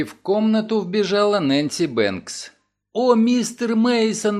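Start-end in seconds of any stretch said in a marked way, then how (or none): 2.9-4.4 high, slow breathless cry of relief; Nancy crying out in relief to Mason; exclaiming. Acted. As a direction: greet him like rescue has come. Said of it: "воскликнула она".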